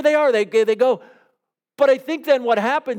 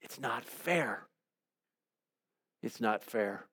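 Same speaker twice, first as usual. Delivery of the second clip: much softer and slow, almost under the breath; hushed complaint; 7 semitones lower, 15 dB quieter, slower than usual. Acted. Preacher echoing the workers' grumble, hushed and slow. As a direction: let the words sink, quiet and drawn out.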